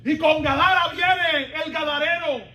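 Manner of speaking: high, loud sermon proclamation; full-force preaching. Real, not acted. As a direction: build each phrase like a revival preacher.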